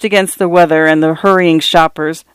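ordinary delivery

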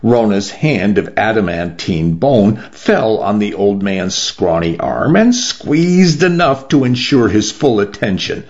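usual speaking voice

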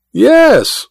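high, mocking echo; exaggerated high-pitched yes; mimicking Goodall's tone. A narrator's reading. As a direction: mimic the other man's amazement. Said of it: "mimicking Goodall's tone".

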